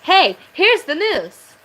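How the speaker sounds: bright mock news intro; mock announcer. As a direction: ham up a cheery news host. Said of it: "be like".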